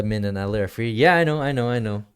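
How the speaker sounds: usual speaking voice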